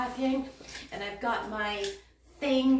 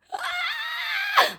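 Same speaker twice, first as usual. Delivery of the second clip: very high, long excited squeal; squealing gasp. Real, not acted. Vlogger hears something arrive, squealing gasp. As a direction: squeal in astonishment.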